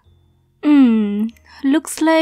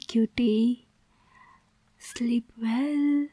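whisper; 8 dB quieter, slower than usual. Acted. Lulling captive back to sleep.

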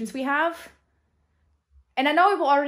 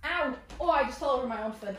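usual speaking voice

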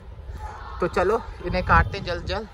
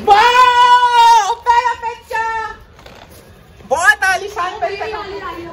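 long, high, sung-out wow; delighted cry; 12 semitones higher, 8 dB louder, much slower than usual. Real, not acted. Vlogger cheering his first kite photo, long sung wow. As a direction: let the wow soar over the rooftop.

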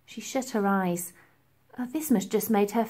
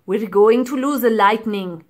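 put-on urgent, louder commanding old man; stern professor; 9 dB louder than usual. A narrator's reading. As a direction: snap it out as an order. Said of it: "ordered the professor".

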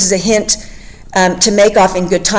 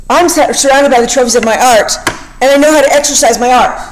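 mild threat; 4 semitones higher than usual. Retold or imitated. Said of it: "he's threatening him".

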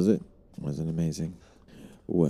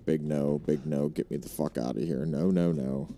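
normal talking voice

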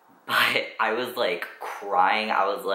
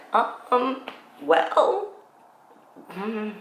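high, much higher squeaky hesitant hum; sheepish stalling; much slower than usual. Retold or imitated. Teen stalls the nurse about the binder in a squeaky, high-pitched voice. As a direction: squeak out an awkward stall, caught out.

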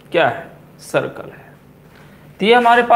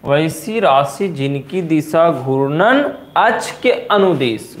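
word by word with long gaps; slow dictation; slower than usual. Shown as a demonstration. Teacher dictating a definition, slowly spaced. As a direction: dictate the definition piece by piece for students to write.

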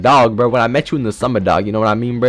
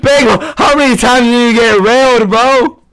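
heckling; 7 semitones higher than usual. Pretend. Teasing Bagel in game.

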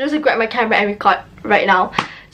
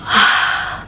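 long, breath-only sigh; weary huff of annoyance; exasperated sigh. Real, not acted. Sighs over the annoying phone trouble.

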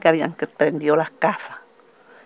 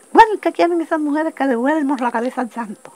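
high-pitched imitated angry demand; retold threat; 10 semitones higher, 3 dB louder than usual. Armed man to crying women, imitated angry threat.

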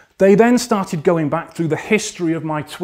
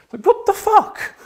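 incredulous outburst. Real, not acted. Outraged at troll accusation.

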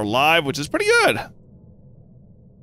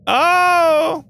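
normal talking voice

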